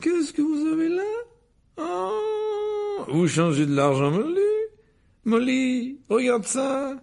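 very high, giddy excitement; delighted. A narrator's reading. Mr Weasley marvels at Muggle money, delighted. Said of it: "avec ravissement".

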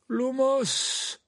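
high, ringing incantation; spell casting. Acted. Dumbledore lights his wand, spell casting.

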